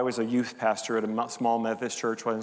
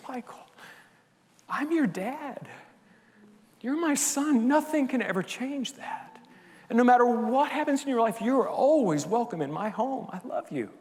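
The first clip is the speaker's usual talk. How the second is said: tender father; 10 semitones higher than usual. Retold or imitated.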